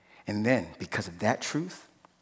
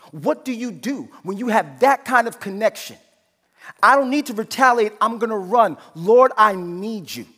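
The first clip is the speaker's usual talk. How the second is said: loud, high, driving sermon delivery; full-force preaching; 12 semitones higher, 10 dB louder than usual. Acted. Preacher urging the congregation not to act tough, loud and fervent. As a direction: preach it out with rising fervor.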